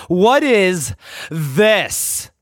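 very slow, high, hammed-up question; milk the reveal; dramatic dad. Father dramatically questioning his son, slow and hammy. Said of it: "very dramatically".

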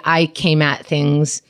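usual speaking voice

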